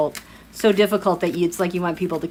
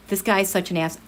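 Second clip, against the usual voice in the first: usual speaking voice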